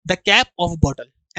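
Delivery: each English word spelled out very slowly for students to copy; teacher reading the correct phrase slowly to the class, slow dictation. Shown as a demonstration. Teacher to students writing the correct form, slow dictation. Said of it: "इसलिए इसको ऐसे लिखते हैं".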